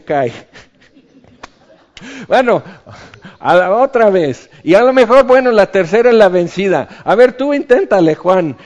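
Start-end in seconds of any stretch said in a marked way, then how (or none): 2.3-8.6 loud, higher, hammed-up coaxing; comic urging. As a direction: cheer him on to try again, laughing it off. Comic Peter failing, retrying. (Pedro anima a Juan a intentarlo otra vez, exagerado y fuerte)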